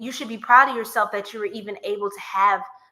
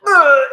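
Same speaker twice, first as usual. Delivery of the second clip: disgusted groan; 3 semitones higher, 13 dB louder than usual. Retold or imitated. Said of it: "The credit was like".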